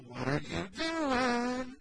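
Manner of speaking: slow, high sing-song "What are you doing?"; teasing cutesy voice for a toddler; playful baby-talk. Shown as a demonstration. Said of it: "pop your head in the room and go".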